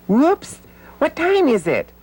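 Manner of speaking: high startled whoops, rushing on; sudden alarm. Acted. Puppet remembering the time with a startled whoops. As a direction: jolt as if you just saw the clock.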